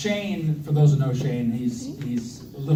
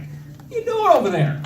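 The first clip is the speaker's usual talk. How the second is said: much higher, incredulous demand; retold exasperation in a sharply raised pitch; exasperated outburst; 3 dB louder than usual. Groom's brother retelling a nervous wait on the phone, exasperated.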